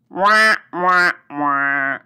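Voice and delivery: sad trombone wah wah. Pretend. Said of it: "Now, that's just sad".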